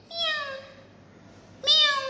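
squeaky high meow like a kitten; high cat meow. Shown as a demonstration. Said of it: "A cat says".

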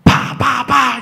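loud, high "pa" bursts; imitated firework bangs. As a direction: fire off explosive pops.

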